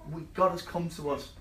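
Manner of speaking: usual speaking voice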